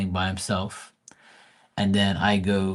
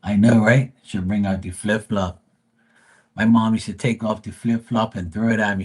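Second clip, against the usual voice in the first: normal talking voice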